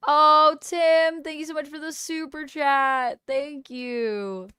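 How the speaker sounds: loud, high gush of gratitude; excited thanks. Real, not acted. Streamer thanks a viewer for a super chat, excited.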